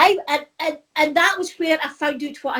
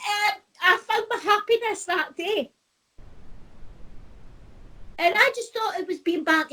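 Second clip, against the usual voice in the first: higher, real tearful voice with a laugh in it; emotional, pitched up after a teary laugh; close to tears; 4 semitones higher, 6 dB quieter than usual. Real, not acted. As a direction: carry on while holding back tears. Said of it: "I'm gonna start crying".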